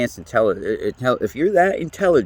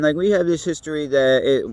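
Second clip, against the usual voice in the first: ordinary delivery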